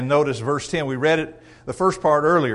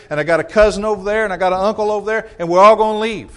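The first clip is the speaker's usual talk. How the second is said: higher, louder listing of threats; bragging bully counting his followers; mock threat; 3 semitones higher, 7 dB louder than usual. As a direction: pile the threats on. Acted member lists family leaving.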